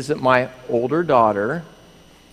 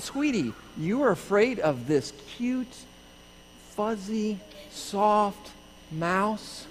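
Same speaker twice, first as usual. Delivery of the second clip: higher and softer, in separated words; cutesy teasing; 7 semitones higher, 5 dB quieter, slower than usual. Retold or imitated. Husband teases wife afraid of baby mouse, cutesy and soft. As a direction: coo each word in mock disbelief.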